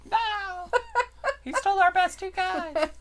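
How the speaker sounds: high, squealing mock panic; panicked quote. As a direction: shriek it like a robbed fan. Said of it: "They'd be like".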